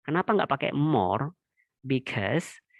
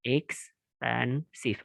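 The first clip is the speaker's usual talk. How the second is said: Expensive drawn out slowly, syllable by syllable; slow syllable demo; 4 dB quieter, much slower than usual. Shown as a demonstration. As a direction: stretch out Expensive to count its syllables. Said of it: "lebih dari dua suku kata".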